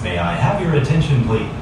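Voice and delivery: ordinary delivery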